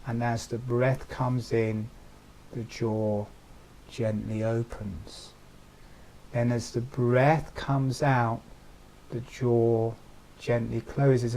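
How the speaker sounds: gentle voice timed to the breath; guided meditation. Acted. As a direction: lull them through each breath. Paces jaw to breath.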